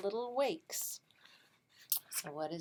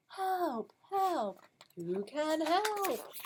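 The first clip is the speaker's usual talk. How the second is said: duck calling for help; 8 semitones higher, much slower than usual. Acted.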